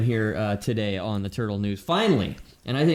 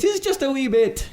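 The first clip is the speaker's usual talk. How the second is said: put-on Scottish accent; 13 semitones higher than usual. Scottish quote, praised after.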